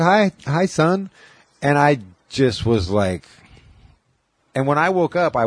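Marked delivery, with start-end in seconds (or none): 0.0-1.1 lightly voiced mother, higher; a gentle mom greeting her son, pitched up; light imitation of a mother saying hi; mom's voice. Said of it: "She said".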